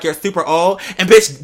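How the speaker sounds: ordinary delivery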